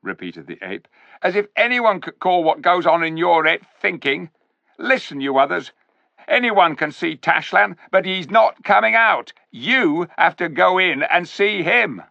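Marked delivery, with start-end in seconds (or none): 1.2-12.0 high, loud sneering ape voice; sly ape. A narrator's reading. Ape sneering at the clever dwarfs, high and loud. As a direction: talk down to the dwarfs like a smug ape.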